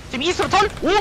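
high, urgent shouted countdown; rally the team to turn now; hyped shotcall. Real, not acted. (다급하게 외치는 콜)

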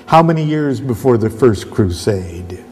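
normal talking voice

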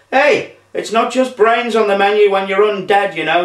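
high, protesting dude voice; zombie dude. A narrator's reading. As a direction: defend yourself as a cool zombie.

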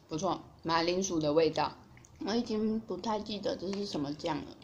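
speaking between bites; talking while chewing. Real, not acted. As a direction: mouth partly full of chicken. Seen 5: Chicken held at mouth, lips closed chewing early; later talks clearly.

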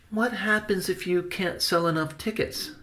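high, light, concerned nephew; high-voiced anxious question; child-like questioner. Benny questions his uncle.

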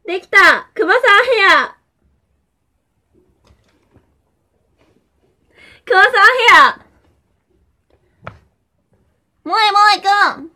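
high, loud ad-style announcement; cheerful commercial catchphrase; excited. Pretend. Hair reveal, ad-style catchphrase. (元気なCM風)

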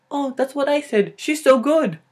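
high, loud gushing; over-excited mock fan voice; enthusiastic Filipino fan. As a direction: gush loudly at a high pitch. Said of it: "you're like".

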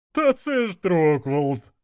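high, drawn-out delighted cry; exclaiming. A narrator's reading. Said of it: "вигукнув Іштван". (протяжний високий вигук)

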